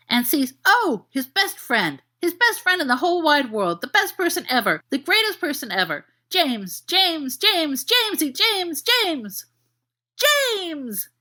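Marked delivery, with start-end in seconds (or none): 6.3-11.1 rising, high shriek of names; shrieking puppy. Acted. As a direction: call him like an ecstatic dog. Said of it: "he fairly shrieks".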